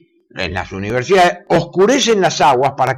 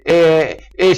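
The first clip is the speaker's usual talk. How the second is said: ordinary talk, nothing marked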